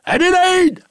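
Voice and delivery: long high yell of her name; bellowed name. Acted. Old farmer bellows for his maid, loud call. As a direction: call her from across the farm at full voice. Master calls maid from afar.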